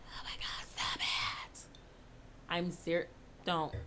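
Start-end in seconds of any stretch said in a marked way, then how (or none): 0.0-1.6 hushed, voiceless whisper; barely-there whispered disbelief; awed whisper. Real, not acted. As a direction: whisper it as if overwhelmed by the idea. Thrilled by Ripper theory.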